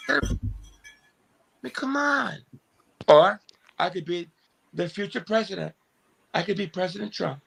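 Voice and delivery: put-on high, halting old man rambling; Joe Biden impression. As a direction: stumble through it like sleepless Biden. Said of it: "working on my Joe Biden".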